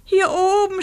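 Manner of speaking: high shout from above. Acted. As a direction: yell it as loud as you can. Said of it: "schrie sie so laut".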